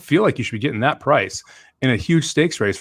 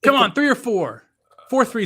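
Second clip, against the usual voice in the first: urging the horses on, pitched way up; yelling at a horse race; 12 semitones higher than usual. Real, not acted. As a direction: scream your picks home down the stretch. Race finish, rooting for horses.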